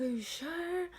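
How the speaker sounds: high-pitched, worried questioning; doubtful. Retold or imitated. Asking mom after being told she's fine, high doubt. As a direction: ask it way up high, unconvinced. Doubting mom's reassurance, acted.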